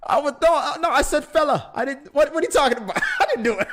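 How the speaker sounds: laughing, indignant denial of a bad accent; laughing protest. Real, not acted. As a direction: protest through laughter, pitch way up.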